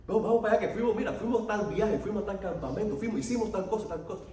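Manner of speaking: high-pitched, rattled-off list of enticements; eager persuading. Retold or imitated. Brother tells of trips to lure him, excited urging. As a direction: imitate an excited brother urging someone along.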